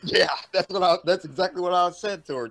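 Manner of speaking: loud, high-pitched burst of agreement; practically shouting yes, that's what I said; excited agreement. Real, not acted. Excited, loud agreement with Turbo about the radio voice.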